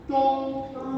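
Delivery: GONG held high and level; high-pitched teaching model of GONG. Shown as a demonstration. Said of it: "it's high up in your voice".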